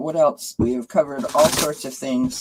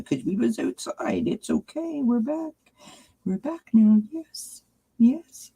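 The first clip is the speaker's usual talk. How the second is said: very high-pitched doting pet voice; 11 semitones higher than usual. Real, not acted. Baby-talking to her dog.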